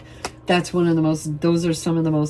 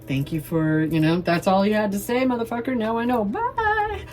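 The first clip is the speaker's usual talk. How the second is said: mock send-off; 6 semitones higher than usual. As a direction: build higher and higher to a squeal. Acted send-off to a critic.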